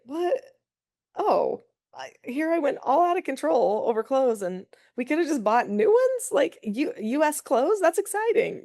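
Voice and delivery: high, bright sudden delight; excited teen voice. Retold or imitated. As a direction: squeal it like surprised teens. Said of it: "they were like".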